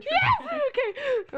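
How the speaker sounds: very high, loud yes; excited yell. Real, not acted. Agreeing to the episode idea, real excited yell. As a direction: burst out in eager agreement.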